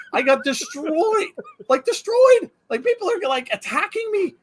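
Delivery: exasperated, climbing pitch; indignant disbelief. Real, not acted. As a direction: stretch "destroyed" in mock outrage. Outraged at online backlash.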